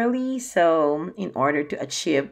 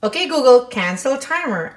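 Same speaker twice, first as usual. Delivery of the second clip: loud command; 6 semitones higher, 9 dB louder than usual. Real, not acted. Yelling at misheard smart speaker.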